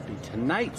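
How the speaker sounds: ordinary talk, nothing marked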